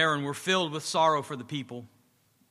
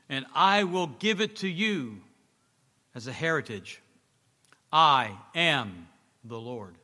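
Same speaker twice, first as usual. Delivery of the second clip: solemn scripture reading; 4 dB louder than usual. Acted. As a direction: read it as God proclaiming, unhurried and grave. Reads God's words from Exodus.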